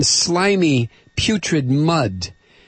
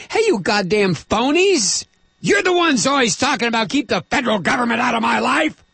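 retold mock yell; 8 semitones higher than usual. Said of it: "I've been screaming at the television".

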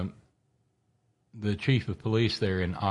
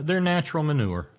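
sarcastic punchline; 7 semitones higher, 4 dB louder, faster than usual. Acted.

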